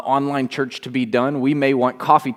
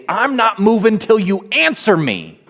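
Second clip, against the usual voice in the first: defiant; 7 semitones higher than usual. Acted. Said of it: "had the courage to stand out and say".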